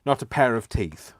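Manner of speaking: loud, punchy stress on "pair". Shown as a demonstration. Punning aperitif into "a pair of teeth", loud stress on "pair". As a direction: hit "pair" hard, loudly. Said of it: "why we're talking about aperitifs".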